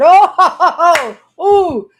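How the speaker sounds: high, loud mocking laugh of a demon; a demon jeering back, loud and high; mocking evil spirit. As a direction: cackle it out like a taunting spirit.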